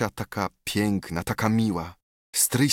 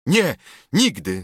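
louder, higher refusal; calling out; 5 semitones higher, 5 dB louder than usual. A narrator's reading. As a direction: cry out no. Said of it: "zawoła Tadeusz".

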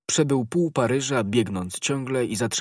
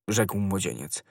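plain reading voice

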